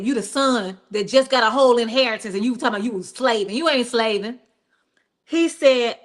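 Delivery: usual speaking voice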